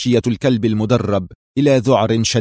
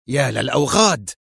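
mild angry curse; furious; 2 semitones higher than usual. A narrator's reading. Mira looks at the two dead dogs, restrained fury. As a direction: curse them under restrained anger. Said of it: "ينعقدان في غضب شديد".

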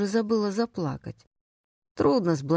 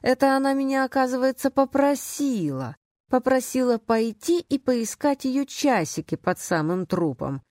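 indignant sarcasm; 6 semitones higher than usual. Acted. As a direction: stretch the words out high, dripping with sarcasm. Narrator's sarcastic outburst at client. (язвительно, нараспев, с возмущением)

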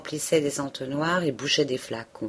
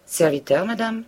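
curt reply; 6 semitones higher, 4 dB louder than usual. A narrator's reading. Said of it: "d'un ton sec".

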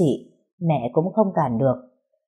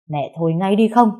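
raised, higher voice, cutting in; angry snap; 4 semitones higher, 6 dB louder than usual. A narrator's reading. Daughter snapping at her mother to stop arguing. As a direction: order her to stop, moderately loud. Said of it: "thì lên tiếng quát".

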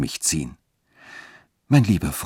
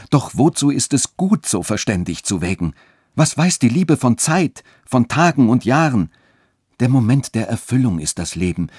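higher, urgent, understated anguish; barely hiding tears; 7 semitones higher, 4 dB louder than usual. A narrator's reading. Friedrich argues for love against her reason, barely hiding tears. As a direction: argue fervently, holding back tears. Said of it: "die Tränen kaum verbergend".

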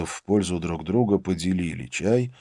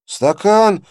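high, loud gurgling indignant cry; gurgling indignant yell; 14 semitones higher, 10 dB louder than usual. A narrator's reading. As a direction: yell it in a strained bubbling voice. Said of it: "возмущённо завопил малец натруженным булькающим голосом".

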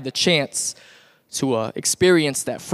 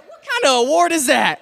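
high-pitched baffled protest; pitched-up comic incredulity; mock indignant disbelief; 10 semitones higher, 3 dB louder than usual. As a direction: act appalled at a silly prize. Acts reaction to an odd award.

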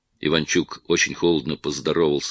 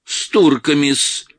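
slow, high, louder mimicry of his -с; mocking mimicry; 9 semitones higher, 6 dB louder, slower than usual. A narrator's reading. Иванчук mocks the actor's words, mimicking him. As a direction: ape the servile -с in "С турками-с". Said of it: "передразнил Иванчук".

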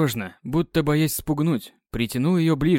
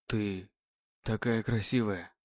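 tender hush; 5 semitones lower, 9 dB quieter, slower than usual. Acted. Tender compliment.

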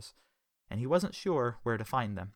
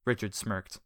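ordinary delivery, nothing marked

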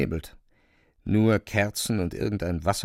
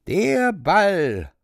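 high, loud, piercing shriek; cat shrieking to open the ball; piercing screech; 10 semitones higher, 5 dB louder than usual. A narrator's reading. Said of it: "kreischte der Kater durchdringend".

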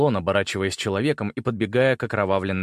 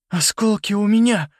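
high, loud trembling call; 10 semitones higher, 5 dB louder than usual. A narrator's reading. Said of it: "дрожащим голосом сказал он". (громко, дрожащим голосом)

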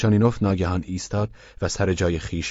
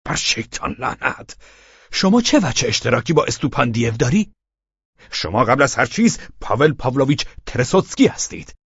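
angry shout; 6 semitones higher, 4 dB louder than usual. Acted. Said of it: "تقریباً لرزان از خشم و غضب فریاد کشید".

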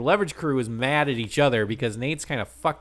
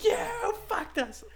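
falsetto; slower than usual. Retold or imitated. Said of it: "the rest of the team's like".

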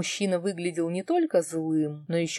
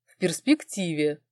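usual speaking voice

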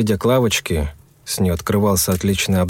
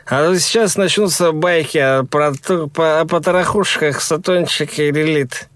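teasing; 7 semitones higher than usual. Acted. Yura keeps mocking Timur.

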